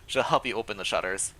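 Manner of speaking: normal talking voice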